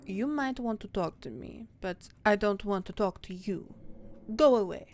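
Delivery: dismissive cat in hammed-up French accent; haughty mock-French cat voice. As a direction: snub them in a bad French accent. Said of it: "Frou Frou has a French accent".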